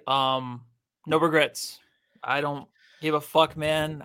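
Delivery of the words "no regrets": normal talking voice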